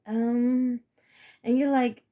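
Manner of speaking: ordinary delivery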